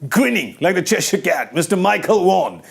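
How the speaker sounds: playful fight-night introduction; ring announcer. Pretend. Mock boxing introduction of a guest.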